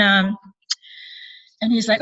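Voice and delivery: normal talking voice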